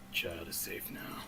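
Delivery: low, hushed man of few words; terse captain, voice dropped right down; stoic guard captain. Acted. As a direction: say it low and very soft, emotionless. Voices the captain emerging from house.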